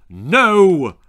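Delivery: loud, sharp roar. Acted. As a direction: roar it at the liar. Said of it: "roared Angela's mother".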